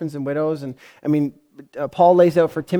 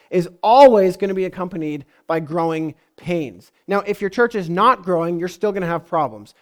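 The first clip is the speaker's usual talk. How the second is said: ordinary talk, nothing marked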